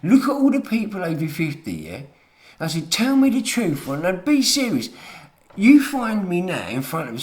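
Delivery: high, loud retold taunt to the youngsters; retold shouted challenge. Telling youngsters in Salisbury off, loud retold shout. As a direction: bark it at them like you did then. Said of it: "And I said to 'em".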